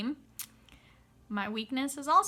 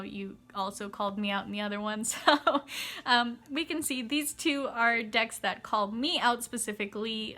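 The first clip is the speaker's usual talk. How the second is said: ordinary talk, nothing marked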